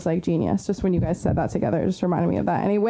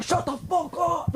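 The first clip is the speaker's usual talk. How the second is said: high, strained imitation of frat guys yelling; mock angry yell; 10 semitones higher, 4 dB quieter, slower than usual. Frat guys yelling at the crowd, mock angry. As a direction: bark it like an annoyed frat bro. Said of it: "they get so mad".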